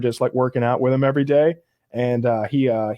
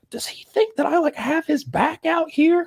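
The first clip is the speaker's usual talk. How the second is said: incredulous inner voice; 14 semitones higher than usual. Acted. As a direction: let the pitch leap in comic disbelief. Acting out incredulous inner thought.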